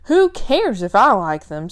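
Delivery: loud, frustrated outburst; exasperated. Acted. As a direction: snap it out in frustration. Billy upset at the tattoos.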